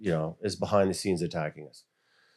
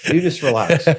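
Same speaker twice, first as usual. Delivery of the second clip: high, loud soothing God; God calming him, louder; voicing God; 5 semitones higher, 7 dB louder than usual. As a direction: tell him to relax, lifted and loud. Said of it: "God's like".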